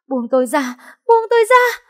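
screaming to be let go; hysterical scream. Acted. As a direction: scream to break free. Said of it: "điên cuồng gào thét".

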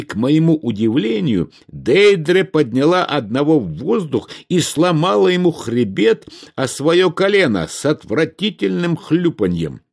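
ordinary delivery, nothing marked

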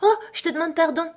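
put-on high, startled girlish apology; cried-out apology. A narrator's reading. Alice realises she offended the mouse, high cried apology. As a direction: catch yourself and exclaim it high. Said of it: "s'écria de nouveau Alice".

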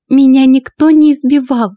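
high-pitched, strained denial; muffled reply. A narrator's reading. Wife denying beating to husband, strained. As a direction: deny it tensely in a lifted voice. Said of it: "глухо отозвалась Мария".

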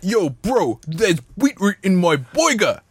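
mock a rapper baffled by beetroot in an American accent; recalling a McDonald's ad, imitating American rappers with a hammed-up American accent; American rapper impression. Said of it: "the American rappers were there, and they're like".

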